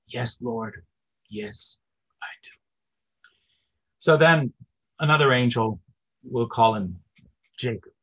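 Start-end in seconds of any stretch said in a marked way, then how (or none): 0.1-2.5 soft, reverent yes to the Lord; devout yes. Retold or imitated. The believer answers his Lord, softly devout. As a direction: hush it like a prayer.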